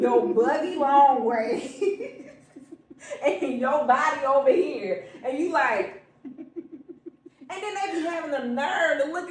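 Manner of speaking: loud slow words broken by laughs; laughing while talking. Real, not acted. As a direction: talk through the laughter. Laughing through complaint.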